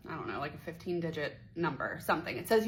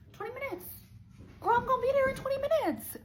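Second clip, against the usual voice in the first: very high squealed promise; shrieky, sky-high mock assurance; frantic reassurance; 5 dB louder, faster than usual. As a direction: squeal it insistently down the phone. Acts her frantic lie on phone.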